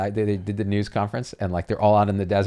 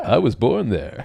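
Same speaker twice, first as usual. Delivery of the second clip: slow, laughing Sulu impression; a light Sulu voice, slowed and chuckling; 3 semitones higher, slower than usual. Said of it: "Sulu is one of the few, uh, voices I can do".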